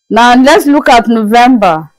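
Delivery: usual speaking voice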